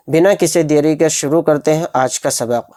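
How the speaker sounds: ordinary talk, nothing marked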